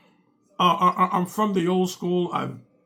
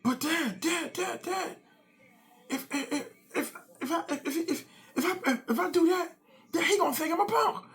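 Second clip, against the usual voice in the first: put-on high whiny kid pleading, much higher; whiny son voice; 11 semitones higher, 4 dB quieter than usual. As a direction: play the seven-year-old begging his dad.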